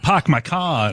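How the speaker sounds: sudden switch into "pahk my cah"; Boston accent. Shown as a demonstration. Bostonian showing how not to talk, sudden Boston accent. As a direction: drop the r's in a put-on Boston accent. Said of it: "slip into my accent all of a sudden".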